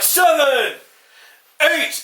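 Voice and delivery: high referee counting out a fallen boxer; mock referee count. Referee counting over the floored boxer, mock count. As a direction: call the count like a ring referee. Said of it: "He'll be like".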